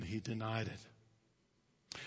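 usual speaking voice

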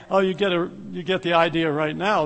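ordinary talk, nothing marked

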